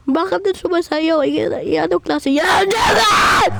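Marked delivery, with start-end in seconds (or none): 2.3-3.5 excited shout. Acted. Shouting at the other player. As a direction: shout it out at full voice.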